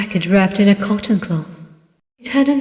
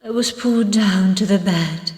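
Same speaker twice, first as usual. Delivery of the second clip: ordinary delivery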